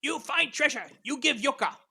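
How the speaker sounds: high, loud goblin in broken language; goblin chieftain. Acted. Goblin chieftain demanding treasure from adventurers, high and loud. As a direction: bellow high, in halting broken speech. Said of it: "in a sort of broken kind of".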